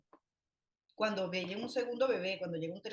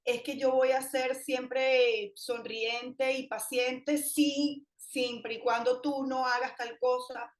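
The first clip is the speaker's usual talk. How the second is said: loud, slow, higher reproach; scolding parent; 4 semitones higher, 5 dB louder, slower than usual. Retold or imitated. Mother scolds her child in a loud, slow blaming tone. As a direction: blame the child hard, dragging it out. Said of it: "reparar nunca pasa por decir".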